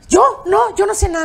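flustered retold denial; mock innocent denial. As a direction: imitate someone playing innocent. Actress denies everything, acted.

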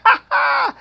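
high-pitched burst of laughter; gleeful high cackle; big laugh. Real, not acted. Host laughs after his own exhortation.

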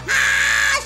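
high, loud mock scream; falling scream. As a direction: scream as if shoved off a roof. Character pushed off the roof.

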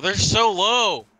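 slow, loud, pressing call; urgent callout. Real, not acted. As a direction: drive the team to push. Urgent callout during firefight.

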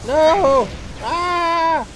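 loud, high cry of dismay; alarmed yell. Real, not acted. Gamer reacts to a bad moment, alarmed yell. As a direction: yell out as it all goes wrong. Crying out as play goes wrong.

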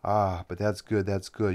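loud, slow, delighted exclaim; excited. A narrator's reading. Master excited by the pupil's plan, slow and loud. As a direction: savour each good with glee. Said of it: "The master was excited by these words".

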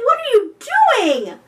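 high, loud startled demand; crying out. A narrator's reading. Benji to the singing dugong, crying out. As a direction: cry out in alarmed disbelief. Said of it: "Benji cried".

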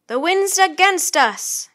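loud, high, drawn-out sailor's call; high, slow shout from a rower. A narrator's reading. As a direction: call it out slowly over the wind. Sailor calling out while rowing.

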